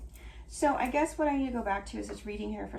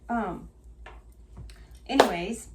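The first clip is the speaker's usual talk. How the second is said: ordinary talk, nothing marked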